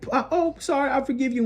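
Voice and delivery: high-pitched, tossed-off mock sorry; glib mock apology. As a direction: play a too-easy forgiveness in a high voice. Mocks an instant apology.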